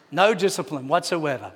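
ordinary talk, nothing marked

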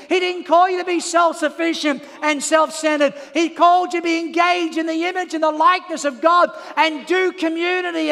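loud, driving sermon delivery; full-force preaching. Real, not acted. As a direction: preach it hard to the crowd.

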